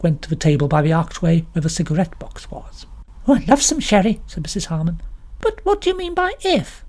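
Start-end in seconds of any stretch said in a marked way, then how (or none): 3.3-6.8 chatty vicar's wife. A narrator's reading. Mrs Harmon eager questions.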